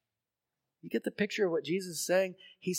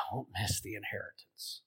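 hushed plea; 7 semitones lower, 11 dB quieter than usual. Real, not acted. Earnest plea to the congregation.

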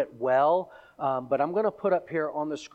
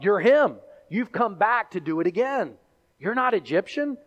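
louder, mock accusation; accusing; 6 semitones higher, 6 dB louder than usual. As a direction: confront him as the impostor. Soldiers accusing Paul.

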